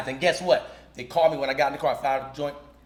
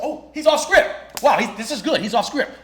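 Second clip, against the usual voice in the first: impressed; 8 semitones higher, 5 dB louder than usual. Retold or imitated. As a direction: marvel at him going off script. Impressed casting director quoted.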